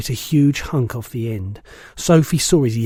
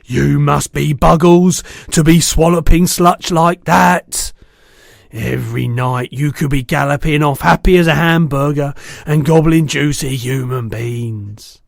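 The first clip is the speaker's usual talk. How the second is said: higher, louder boastful giant voice; a big brute bragging loudly; 3 semitones higher, 8 dB louder than usual. A narrator's reading. Bloodbottler mocking the BFG, loud and boastful.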